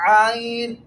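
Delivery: آئین with the voice briefly held back in the throat; held throat ayn. Shown as a demonstration. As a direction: stop the voice a moment, then آئین from mid-throat. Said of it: "میں نے آواز کو تھوڑا روکا ہے".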